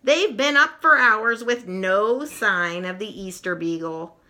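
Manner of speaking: ordinary delivery, nothing marked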